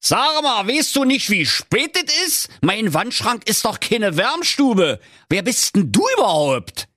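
high-pitched, appalled outburst; horrified old grouch. A narrator's reading. Old miser confronts an intruder in his wardrobe, horrified. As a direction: cry out in horrified indignation. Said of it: "Der alte Knöterich rief voll Entsetzen".

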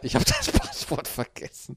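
leise, mit Lachen dazwischen; lachend. Real, not acted. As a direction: durchs Lachen hindurch sagen. Lachend wiederholtes Geständnis. (lachend und leiser)

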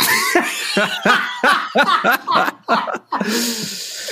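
long helpless laugh; burst of laughter. Real, not acted. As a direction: crack up at the story. Lorenz locked out in underwear.

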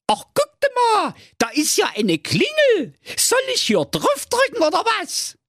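put-on high cheeky little boy; squeaky know-it-all kid. Däumling voiced in fairy tale. (hohe freche Kinderstimme)

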